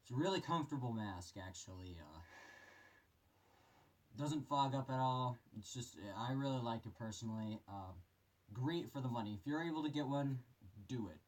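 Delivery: muffled voice inside a respirator; through a gas mask. Real, not acted. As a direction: talk from behind a gas mask. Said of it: "put this mask on here for you guys".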